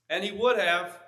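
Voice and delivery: ordinary delivery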